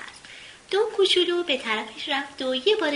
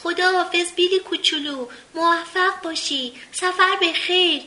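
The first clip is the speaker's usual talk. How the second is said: calling out; 5 semitones higher, 5 dB louder than usual. A narrator's reading. Minpins calling farewell to Billy on the swan, shouting. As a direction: call out goodbyes across the branches.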